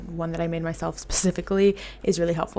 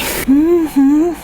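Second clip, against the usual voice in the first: loud laugh; 8 semitones higher, 8 dB louder than usual. Real, not acted. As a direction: burst of high laughter. Laughing at her song obsession.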